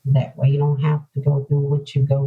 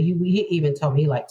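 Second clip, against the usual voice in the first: ordinary delivery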